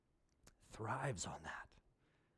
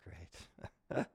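low, soft and content; God's pleased reply; 9 semitones lower, 6 dB quieter than usual. Acted. Preacher voicing God answering a child's plea, low soft and pleased. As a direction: say it gently, like a pleased father. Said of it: "And God says".

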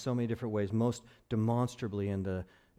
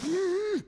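high sudden gasp; sharp mock gasp. Pastor acting out being riled, mock high gasp. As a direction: gasp as if provoked.

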